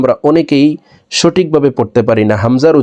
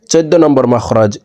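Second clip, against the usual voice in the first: ordinary talk, nothing marked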